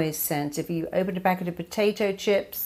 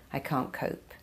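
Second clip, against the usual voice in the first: "I can't cope" breathed out, barely voiced; a beaten whisper of giving up; defeated whisper; 3 semitones lower, 9 dB quieter than usual. Shown as a demonstration. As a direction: let it collapse into a whisper. Mimicking an overwhelmed person.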